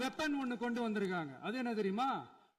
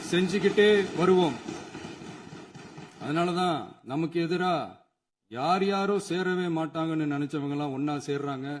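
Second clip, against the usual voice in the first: loud, lower, declaiming oratory; rousing political speech; 6 semitones lower, 6 dB louder than usual. Real, not acted. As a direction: build up to செஞ்சுக்கிட்டே வருவோம் like a rally cry. Rally speech at full force. (உரத்த மேடைப் பேச்சு)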